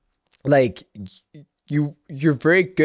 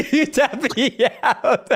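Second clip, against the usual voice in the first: laughing echo; 9 semitones higher, slower than usual. Retold or imitated.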